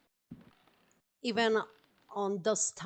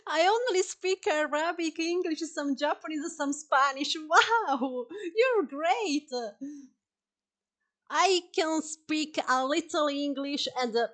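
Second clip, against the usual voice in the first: excited chat; 7 semitones higher, 7 dB louder than usual. Real, not acted. Chatting excitedly with chat viewers.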